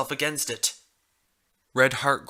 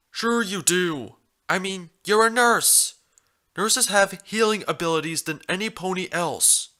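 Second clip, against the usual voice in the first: insistent stallion voice; 7 semitones higher than usual. Acted.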